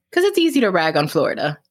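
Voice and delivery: usual speaking voice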